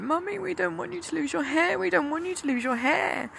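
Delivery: put-on high, small child's voice, pleading; high childlike plea; imitating crying children. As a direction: mimic her tearful kids begging. Children crying, mother imitates them.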